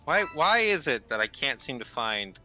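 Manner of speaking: normal talking voice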